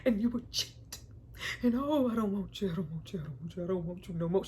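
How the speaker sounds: fading from firm to a hushed broken whisper; mock her heartbroken refusal, dropping to a whisper; imitating Gloria. Quoting the mother rejecting the father, fading to whisper. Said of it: "giving that great".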